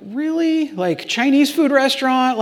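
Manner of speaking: high-pitched disbelieving reply; incredulous. Retold or imitated. Acting out his reluctant reply to God.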